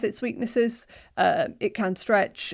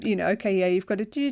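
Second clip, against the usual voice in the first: ordinary delivery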